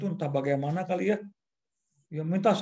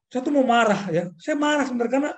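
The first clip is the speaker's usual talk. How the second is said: higher and louder, heating up with frustration; rising anger; 8 semitones higher, 5 dB louder than usual. Retold or imitated. Telling the host about his lecturer, rising angry voice. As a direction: let the anger build as you speak.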